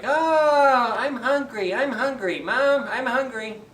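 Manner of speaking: put-on high whining children's voices; high, drawn-out child whine; whiny hungry kids. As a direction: whine like starving little kids.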